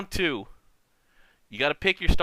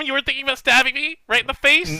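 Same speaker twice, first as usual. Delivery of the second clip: mock outrage; 12 semitones higher, 6 dB louder than usual. Mock outrage at being betrayed.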